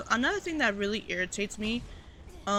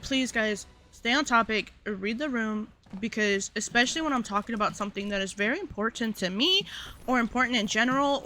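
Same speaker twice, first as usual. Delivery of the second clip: usual speaking voice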